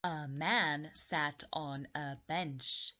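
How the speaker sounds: ordinary delivery, nothing marked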